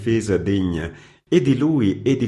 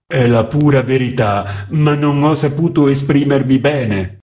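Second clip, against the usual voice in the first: flustered; 6 semitones higher, 7 dB louder than usual. A narrator's reading. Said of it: "disse l'impaginatore confuso".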